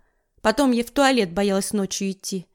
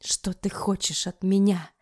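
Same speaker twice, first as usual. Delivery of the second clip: whispering; 3 semitones lower, 4 dB quieter than usual. Acted. Cornered youth speaks low.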